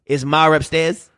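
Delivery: Boston accent, "Ma" gains an R and "upstairs" drops it. Shown as a demonstration. Showing the rule on an example, slow Boston accent. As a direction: say "Ma" as "mar", "upstairs" as "upstehs", Boston-style. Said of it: "you add an R".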